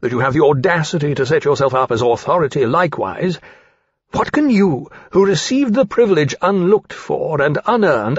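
indignant rebuke. Acted. An aviator rebukes an upstart over his duty, indignant. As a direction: lecture with offended authority. Character's indignant speech in quarrel.

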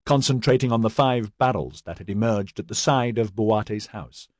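ordinary delivery, nothing marked

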